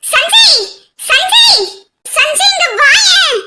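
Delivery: loud, high call across the house; calling out to Sanjay from upstairs. Acted. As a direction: shout for Sanjay to come, slow and drawn out.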